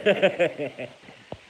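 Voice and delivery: a big loud cackle bursting out; loud burst of laughter. Real, not acted. Laughing out loud at a viewer's comment about the tower.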